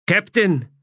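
snapped high and loud; sharp command. A narrator's reading. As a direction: bark the order. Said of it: "snapped the plain woman".

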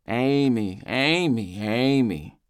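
singsong, rising-and-falling taunt; mock the name with each repeat. A narrator's reading. Drunk Eldest taunting about Amy, mocking. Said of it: "he mocks".

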